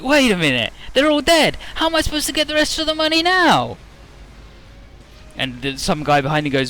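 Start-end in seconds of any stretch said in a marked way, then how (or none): 0.0-3.8 much higher, louder hammed-up villain alarm; mock dismay. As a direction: overplay the villain realising his mistake. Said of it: "And he's like".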